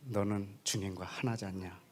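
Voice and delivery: soft, low, tender reply; calm the angry man with it; gentle answer. Retold or imitated. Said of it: "주님이 주신 답이". (부드럽고 다정하게)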